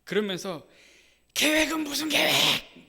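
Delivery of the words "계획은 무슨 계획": high, loud, slow outburst; retold shout. Bereaved mother screams at the pastor, high and loud. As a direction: hurl it back in grief and anger. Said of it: "막 소리칩니다".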